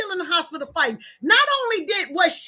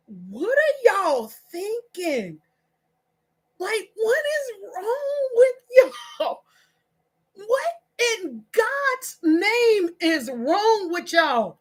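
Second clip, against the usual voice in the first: slow, drawn-out incredulous questioning; exasperated disbelief; 4 semitones higher, much slower than usual. Real, not acted. Scolding viewers over their behaviour, slow incredulous exasperation. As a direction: stretch each word, pausing in disbelief.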